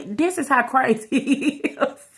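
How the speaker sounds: words breaking up into laughter; laughing. Real, not acted. Telling how crazy he is, dissolving into laughter. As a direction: start the line, then crack up on the end.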